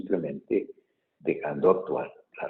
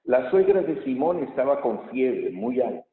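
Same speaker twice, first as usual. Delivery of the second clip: raised, solemn proclamation; gospel reading; 4 semitones higher, 3 dB louder than usual. Real, not acted. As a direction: read it out to the whole church.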